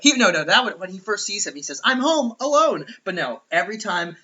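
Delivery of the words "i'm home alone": put-on kid line. Kevin's line.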